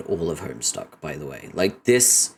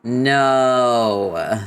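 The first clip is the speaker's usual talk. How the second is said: long, loud, higher "no"; drawn-out cry of no; 4 semitones higher, 5 dB louder than usual. Acted. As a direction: stretch it out in mock protest. Acted drawn-out cry of refusal.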